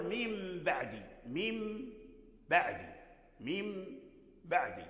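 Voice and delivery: "من بعدي" as mim ba'di, the N turned into a long hummed M; slow teacher's model of "من بعدي" with M replacing N; iqlab demo. Shown as a demonstration. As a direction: hold a soft nasal M in "من" before releasing "بعدي". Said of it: "والتقت مع الباء بعد تقول".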